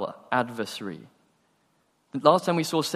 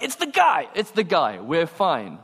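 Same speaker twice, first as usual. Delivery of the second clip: excited relief; 3 semitones higher than usual. Acted. Player spotting the awaited star arriving, excited. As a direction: cry out in delighted recognition.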